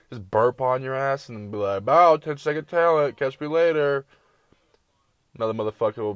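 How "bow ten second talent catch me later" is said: loud, higher mock bragging; cocky show-off. Kid showing off a burp on Snapchat, loud mock boast. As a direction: announce it like a proud show-off.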